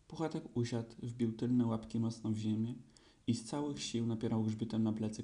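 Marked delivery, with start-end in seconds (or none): none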